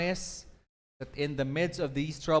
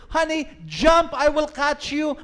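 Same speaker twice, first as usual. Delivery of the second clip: high, loud urgent call upward; father shouting; 11 semitones higher, 9 dB louder than usual. Acted. Father shouts up to daughter through the smoke, urgent yell. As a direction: yell up to the trapped child to jump.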